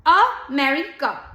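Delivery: America split slowly, weight on 'meri'; stress on MERI. Shown as a demonstration. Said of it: "सारा जोर हम Mary पे देते हैं". (अमेरिका धीरे, 'मेरि' पर ज़ोर)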